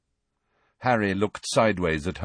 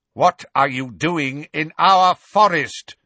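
slow, loud bellow; 6 semitones higher, 7 dB louder than usual. Acted. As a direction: bellow it slowly. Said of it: "bellowed the hard-faced grey centaur".

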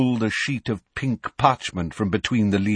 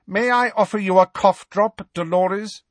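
curt; 11 semitones higher, 3 dB louder than usual. Acted. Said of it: "asked curtly".

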